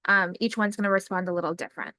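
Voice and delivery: normal talking voice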